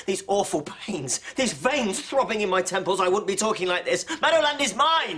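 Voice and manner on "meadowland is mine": higher and louder, hammering mine; yelling. Acted. Neighbours in a yelling match over land. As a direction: bellow it as a final claim. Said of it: "What are you both yelling for".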